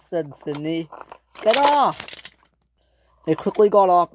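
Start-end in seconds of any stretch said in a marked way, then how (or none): 1.4-2.1 urgent order. Acted. Sydney on the breaking bridge.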